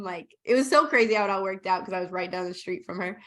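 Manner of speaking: ordinary talk, nothing marked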